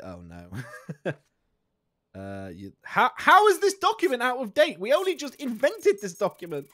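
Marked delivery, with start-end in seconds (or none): 2.8-6.7 high, loud disbelief; can't believe the paper's already expired; incredulous outburst. Real, not acted. Border guard scolds a traveller, loud and disbelieving. Outraged at an out-of-date document.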